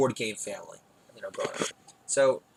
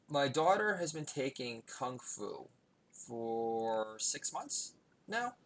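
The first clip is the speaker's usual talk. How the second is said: ordinary delivery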